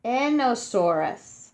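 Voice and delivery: Enosaurus said as EN-o-SAU-rus, no H sound; careful pronunciation. Shown as a demonstration. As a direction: sound out Enosaurus clearly for kids. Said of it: "You say it like this".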